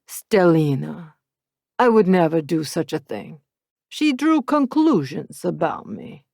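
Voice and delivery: smooth and amused, calming her; Matteo. Acted. Matteo reassures his girlfriend, smooth Italian-accented voice.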